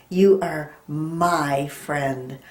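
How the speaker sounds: You are my friend said very slowly; slow teacher model sentence; slow example sentence. Shown as a demonstration. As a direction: say it slowly for young kids.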